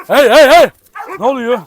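loud, high warning yell; sharp shout to break up a dog scuffle; alarmed shout. Real, not acted. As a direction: stop the dogs at once. Dogs start fighting.

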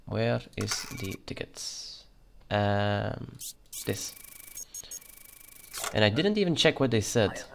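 put-on Russian accent all through the playthrough; a border guard's mock Russian accent. Youtuber playing a border inspector, in a Russian accent. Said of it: "I will be keeping my Russian accent".

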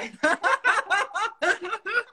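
high rising laughter. Real, not acted. Partner laughing at the joke about who plays the woman, in high laughter.

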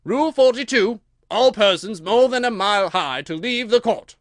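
loud, high proclamation; booming official announcement; reading out. A narrator's reading. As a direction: read the rule out to the whole court. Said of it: "read out from his book".

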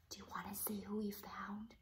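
quick hushed whisper to build suspense; soft suspenseful whisper; whispered question. Real, not acted. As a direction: whisper to tease the reveal. Teasing question to child.

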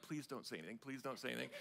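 very quiet, low imagined pleading; hushed plea. Retold or imitated. Introverted family silently willing him not to speak, hushed plea. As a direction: beg softly under your breath, voicing someone else.

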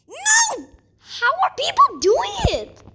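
high-pitched wail of disbelief; exasperated wail. Real, not acted. Player asks how others manage, exasperated wail. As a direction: cry out high in frustration. Fails again in game.

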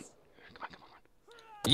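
breathless whispered urging; whispering. Real, not acted. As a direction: whisper it under your breath, egging on. Urging a sniper target.

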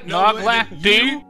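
ordinary talk

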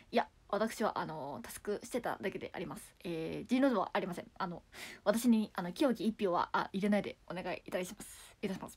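low mimicked campaign-speech voice; Abe impression. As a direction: plead like a prime minister asking for votes. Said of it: "元安倍総理さんのモノマネ".

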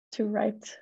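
ordinary talk, nothing marked

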